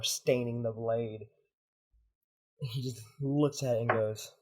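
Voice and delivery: usual speaking voice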